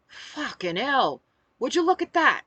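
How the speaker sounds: put-on loud, clumsy stab at a British accent; attempted British accent. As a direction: exclaim it in a badly faked British accent. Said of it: "I can't do a British accent".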